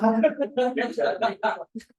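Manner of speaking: long, high burst of laughter; loud laughter. Real, not acted. Coach reacting after the demo, laughing loudly.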